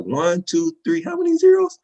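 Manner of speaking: put-on high-pitched kid counting in growing disbelief; imitating an amazed child, pitch climbing steeply; daughter working it out. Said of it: "like, the wheels was turning".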